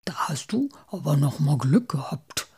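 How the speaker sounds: low, breathed relief; hushed, relieved character voice. A narrator's reading. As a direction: half-whisper it with relief. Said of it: "sagte Muff erleichtert".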